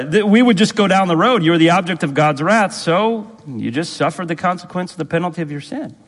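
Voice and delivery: ordinary talk, nothing marked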